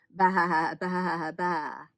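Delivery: wobbly storybook baas; sheep bleating. A narrator's reading.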